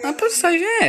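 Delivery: high excited squeal; thrilled, pitch jumps way up. Real, not acted. Sudden find in the cave.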